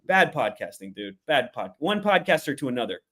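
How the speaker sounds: usual speaking voice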